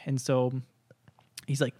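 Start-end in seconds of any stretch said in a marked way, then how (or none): none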